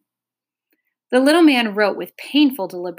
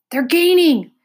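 urgent shout, higher and louder; 8 semitones higher, 8 dB louder than usual. A narrator's reading. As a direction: yell it in panic. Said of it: "shouted the humbug".

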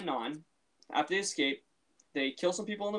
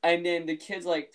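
ordinary delivery

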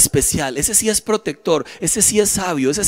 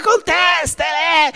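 high, drawn-out shouted urging; mock inner shout; 9 semitones higher, much slower than usual. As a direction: shout it like a tempting inner voice, stretched out. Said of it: "Una parte de mí me gritaba". (grito agudo y alargado de la tentación)

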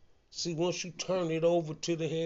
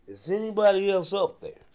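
raised, higher shout up to someone above; louder, higher storytelling shout; retold shout; 6 semitones higher, 6 dB louder than usual. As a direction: call up loudly, acting out the hanging man. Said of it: "the man shouted".

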